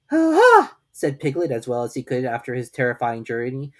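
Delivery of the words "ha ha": high, loud, forced little aha; weak forced aha. A narrator's reading. Piglet after his terrifying journey, a feeble aha. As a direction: fake a brave laugh that falls flat. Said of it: "it wasn't a very good aha".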